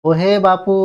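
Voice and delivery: ও হে বাপু with long উ in বাপু; drawn-out call. Shown as a demonstration. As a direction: hail someone, holding বাপু long. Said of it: "এরকম".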